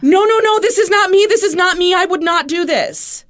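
high, loud, desperate denial; retold sobbing protest. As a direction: imitate a distraught man pleading innocence. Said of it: "he's just sobbing".

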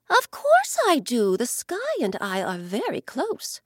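the ocean, fond of her friend, lightly voiced.

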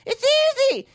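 high, far-off mocking voice of smug critics; mocking preachy critic. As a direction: sneer it way up high like a know-it-all. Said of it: "They're just like".

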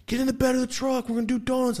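very high-pitched eager call; excited dad. Retold or imitated. Dad calling his son to do donuts, high and eager. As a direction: imitate a partying dad rallying his kid.

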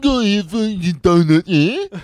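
badly faked British, high and loud; British accent. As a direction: do a silly, pushy British accent. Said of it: "do your British impression".

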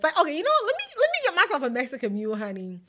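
high, fast and eager; excited self-treat. Real, not acted. Deciding on her own drink order, high and quick. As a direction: snap up the idea gleefully.

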